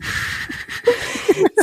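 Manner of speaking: high, airless burst of laughter; breathy laugh. Real, not acted. Cohost laughs at the absurd movie quote, breathless laughter. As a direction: laugh helplessly at the movie line.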